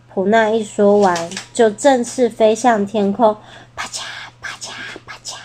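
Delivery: ordinary delivery, nothing marked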